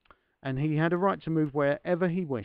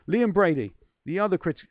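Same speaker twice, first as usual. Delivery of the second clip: put-on louder, higher Irish-accented start on "Liam Brady"; 4 semitones higher, 8 dB louder than usual. Slipping into the quote voice too early, in an Irish accent. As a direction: give "Liam Brady" a rough Southern Irish lilt. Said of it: "the wrong part of Ireland that I'm doing".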